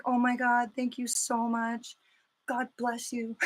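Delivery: grateful gushing. Retold or imitated. Thanking the mailman who found her package, hushed gushing. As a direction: gush thanks, hushed and relieved. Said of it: "I was like".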